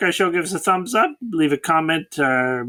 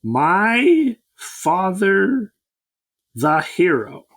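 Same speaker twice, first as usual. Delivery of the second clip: slow dramatic title reading; 4 semitones higher, much slower than usual. Acted. Repeating the movie title.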